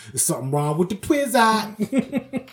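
slow, drawn-out movie-quote voice; Martin Lawrence quote. Retold or imitated. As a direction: deliver it like the comic in the film. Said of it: "Martin Lawrence was talking about".

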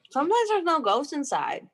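ordinary talk, nothing marked

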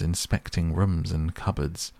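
ordinary delivery, nothing marked

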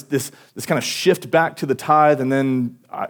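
ordinary delivery

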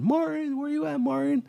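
high calling voice, shouting a name; game character calling for Martin. Retold or imitated. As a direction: call across the woods for a lost friend. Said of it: "screaming this dude's name".

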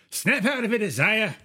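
high, loud shout to snap someone out of it; urgent yell. Acted. Bard yells at frozen ally to inspire him, urgent yell. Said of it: "and just yell at him".

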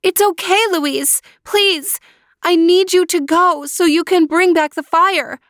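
put-on high, pleading young girl voice; high young sister pleading; girl calling out. A narrator's reading. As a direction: call to your brother through the wall. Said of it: "she urged him, saying".

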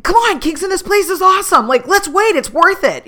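urging brother to wait, exasperated; mad pleading. Retold or imitated. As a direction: push him to stay, annoyed. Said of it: "And then I got mad".

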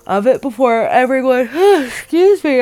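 talking through a yawn. Real, not acted. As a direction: stretch the words with a yawn. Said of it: "I don't know why I'm yawning".

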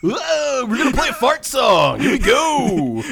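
high, loud zany radio host; Morning Zoo DJ. Acted. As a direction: crank up the wacky DJ energy. Continuing Morning Zoo bit.

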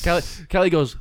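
ordinary talk

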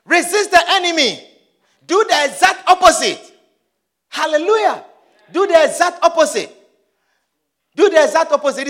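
loud, high exhortation; forceful preacher's refrain; full-force preaching. Acted. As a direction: command the congregation in short bursts.

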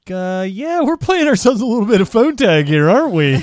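high, loud mock-playful needling; Cable Guy voicemail. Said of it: "and he's just like".

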